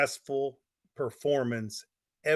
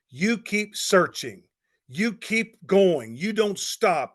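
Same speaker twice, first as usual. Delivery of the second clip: slow, punched phrases with pauses between; slow, emphatic exhortation; 4 dB louder, slower than usual. Real, not acted. Coach urges marketers not to quit, slow emphatic drumbeat. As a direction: hammer out each phrase in the same falling rhythm. Motivational chant of repeated exhortations.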